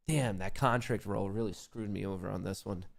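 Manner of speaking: ordinary talk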